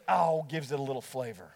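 put-on low, easygoing country-boy reply; the host brushing off his horrified guest, lightly voiced. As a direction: shrug it off like a good old boy. The host's casual retort.

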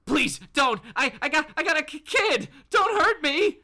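high, panicky stammer; stuttering, frightened pleading. Acted. Bob begging Batman not to hurt him, stammering in fear. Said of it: "kinda stuttering".

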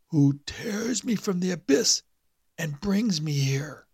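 high, slow rasp sinking into a whisper; a corpse croaking up from the abyss; the awakened dead man's dry rasp; dry raspy dead man. A narrator's reading. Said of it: "His voice was dry and raspy".

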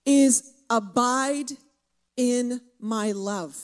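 abide in my love, drawn out and loud; preaching a command with weight; slow, loud emphasis. Acted. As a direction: lean hard on abide, slowly.

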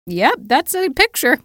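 high, unimpressed sarcasm; sarcastic deadpan. Retold or imitated. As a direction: shrug it off sarcastically. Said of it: "And I'm like".

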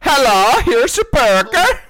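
high, loud mock-Swedish accent; slow singsong Swedish caricature. Burger lady greeting a customer, in a hammed-up Swedish accent. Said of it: "fucking Swedish".

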